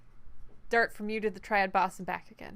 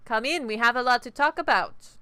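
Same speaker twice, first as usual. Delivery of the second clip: booming welcome to the newcomer; loud greeting; 3 semitones higher, 10 dB louder than usual. Acted. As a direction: call them in, loud and big. NPC greets the player.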